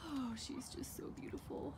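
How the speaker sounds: soft breathless whisper; whispering in awe. Real, not acted. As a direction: hush it out in awe. Admiring her new car.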